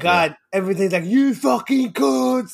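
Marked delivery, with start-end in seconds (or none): 1.1-2.5 high, drawn-out Scottish swearing, slow; Scottish accent. Acted. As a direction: spit it out like a wild Scottish film character. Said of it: "greatest representation of the Scottish accent".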